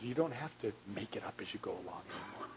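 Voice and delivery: breathy whispered reassurance. Pretend. God coming alongside the fatherless, whispering. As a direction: lean in and whisper the comfort.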